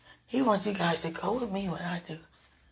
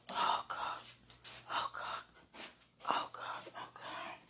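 breathy whispered prayer; whispering; 15 dB quieter than usual. Real, not acted.

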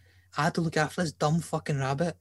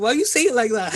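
higher, louder mock protest; mimicking the listener's complaint; 7 semitones higher, 8 dB louder than usual. Imitating co-host's reaction to being laughed at, mock protest. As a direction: throw the question back, raised and loud. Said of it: "you can be like".

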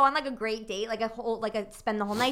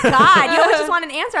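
mock exasperation; 4 semitones higher, 8 dB louder than usual. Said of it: "And he'd be like".

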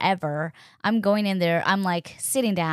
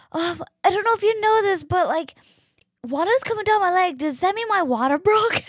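much higher, sheepish and quick; nervous high-pitched blurt to the in-law; embarrassed phone call, voice way up; awkward high voice; 11 semitones higher, 5 dB louder than usual. Retold or imitated. Telling brother-in-law awkwardly.

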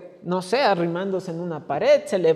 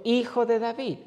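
normal talking voice

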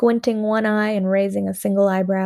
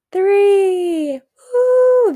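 high loud joyful whoop; excited cheer; 11 semitones higher, 7 dB louder than usual. A narrator's reading. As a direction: shout it as leaves fly. Throwing leaves, cheering.

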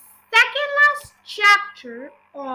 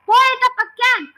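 higher, louder order from an excited man; excited cry; 6 semitones higher, 9 dB louder than usual. A narrator's reading. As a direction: cry it out, high and loud. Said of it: "cried Mister Cranky".

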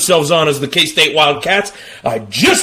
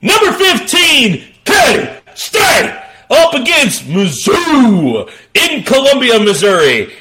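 announcer call; 7 semitones higher, slower than usual. Acted. Announcing the first game.

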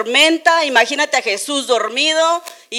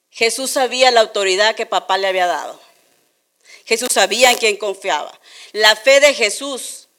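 ordinary talk, nothing marked

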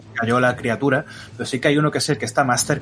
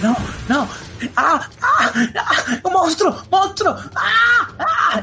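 high, loud hysterical shrieks of terror; panicked screaming; 4 dB louder, slower than usual. Acted. GM voices panicking Regina shrieking at the monster. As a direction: scream as a terrified child sees a monster. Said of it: "ha empezado a gritar, a llorar".

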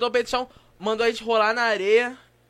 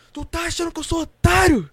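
raised, higher voice in mock outrage; loud retold dressing-down; angry sergeant; 7 semitones higher, 7 dB louder than usual. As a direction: act out a sergeant's indignant challenge. Sergeant confronts boys, acted in story.

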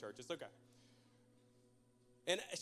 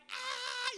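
very high mimicked squeal of laughter; mock high laughing squeal; imitated laughing shriek; 13 semitones higher than usual. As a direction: imitate someone laughing so hard it turns to a shriek. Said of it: "she's laughing so hard".

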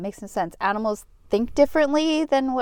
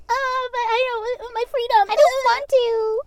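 much higher mock whine; 10 semitones higher than usual. Friend mocking people who refuse masks, in a whine. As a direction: sneer as a spoiled protester.

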